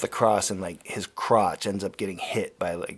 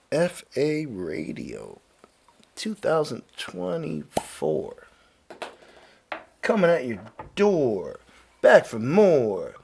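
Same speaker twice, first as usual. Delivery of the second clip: mock radio jingle; 3 semitones higher, slower than usual. Show sign-off jingle.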